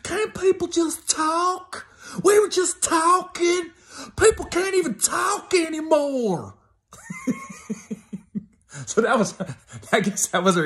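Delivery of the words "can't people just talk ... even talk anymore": very high, slow, whining protest; hammed-up indignant girlfriend wail; whiny girlfriend. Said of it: "and like".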